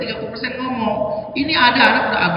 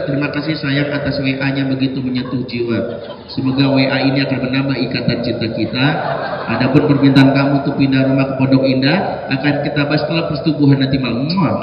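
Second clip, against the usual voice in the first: lower, mock-flowery reply with laughs; mock romantic husband; 8 semitones lower than usual. Husband pretending to answer wife's long WhatsApp, mock flowery. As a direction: ham up the impossible sweet reply. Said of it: "nggak mungkin ... dibalas panjang".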